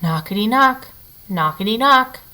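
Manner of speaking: plain reading voice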